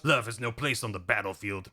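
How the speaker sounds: low, stoic action-hero voice; mock-solemn deep hero line; Snake. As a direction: brush off romance like a brooding soldier. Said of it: "And Snake goes".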